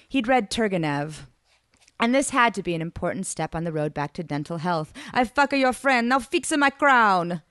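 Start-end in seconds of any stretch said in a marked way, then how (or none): 5.1-7.4 slow, blunt mock Italian; hammed-up crude bargain. Narrator mock-voicing deal.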